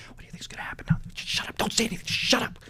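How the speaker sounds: hushed schoolboy whisper, barely voiced; whispering boys. Boys waiting in silence whisper nervously to each other. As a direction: whisper so the teacher can't hear.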